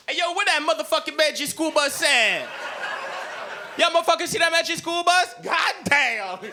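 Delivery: very high, hammed-up caricature kid; high, rowdy caricature of a kid caller.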